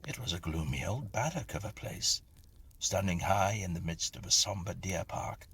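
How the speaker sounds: ordinary delivery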